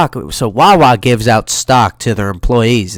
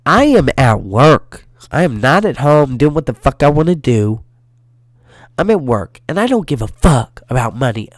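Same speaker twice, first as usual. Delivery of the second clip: ordinary talk, nothing marked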